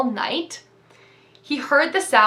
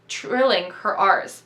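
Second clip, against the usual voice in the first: ordinary delivery, nothing marked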